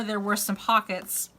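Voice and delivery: normal talking voice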